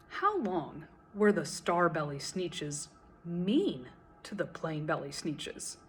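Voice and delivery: plain reading voice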